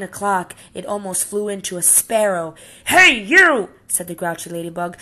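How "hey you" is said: high, loud shout at a stranger; bully's call. Acted. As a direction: pick a fight from across the room. Grouchy ladybug picks fight.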